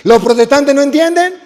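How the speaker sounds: preacher calling a line to the congregation; loud cue to repeat. Shown as a demonstration. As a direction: call the line out for them to answer. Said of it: "Otra vez".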